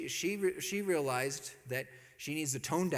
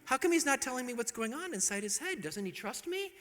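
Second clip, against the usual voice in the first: higher-pitched, hurt questioning; puzzled wondering; 7 semitones higher than usual. Retold or imitated. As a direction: puzzle over him, a little hurt. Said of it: "she's over here wondering".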